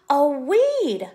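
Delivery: startled, spot the weed; alarmed gasp. Acted. Alarmed discovery.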